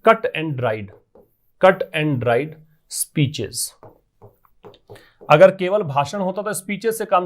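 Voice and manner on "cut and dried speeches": each word drawn out slowly for note-taking; very slow dictation. Shown as a demonstration. Teacher dictating the phrase to students, very slow. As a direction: spell it out slowly so the class can write. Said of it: "बने बनाए भाषण को बोलते हैं".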